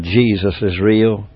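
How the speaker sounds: put-on hushed, slow comfort for a young prisoner; gentle quiet reassurance. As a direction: say it softly to comfort a lonely boy. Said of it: "I said".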